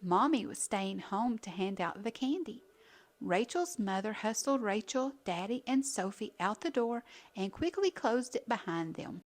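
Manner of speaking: ordinary delivery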